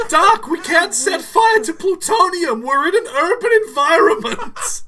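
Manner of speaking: high, loud panicked protest; hammed-up frantic teenage sidekick; Marty. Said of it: "Marty's gotta have".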